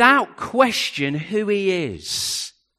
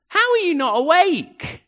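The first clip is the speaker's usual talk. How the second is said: incredulous cry; 11 semitones higher, 3 dB louder than usual. Acted. Disciples waking Jesus mid-storm.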